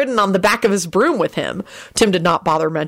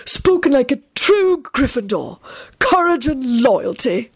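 put-on slow, raised-pitch, beaming old headmaster; Dumbledore; 7 semitones higher, slower than usual. A narrator's reading. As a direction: savour the praise slowly. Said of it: "Dumbledore beamed".

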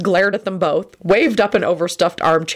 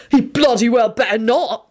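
threatening; 6 semitones higher than usual. A narrator's reading. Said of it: "Sirius proclaimed threateningly".

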